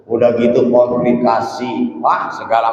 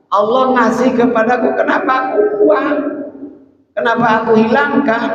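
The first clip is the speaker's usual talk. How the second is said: much higher, pleading voice; high impassioned plea; 10 semitones higher than usual. Retold or imitated. Preacher voices saint marvelling at God's gift. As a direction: ask it up high, in wonder.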